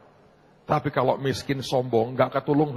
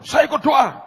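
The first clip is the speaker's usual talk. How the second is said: high, loud retold announcement; shouted declaration; 13 semitones higher, 9 dB louder, slower than usual. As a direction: burst out with it at full voice.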